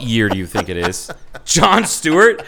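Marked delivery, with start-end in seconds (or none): 1.4-2.4 Jon Stewart blurted much higher and louder, incredulous; mock outrage. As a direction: act scandalised that he named an outdated host. Mock outrage at outdated answer.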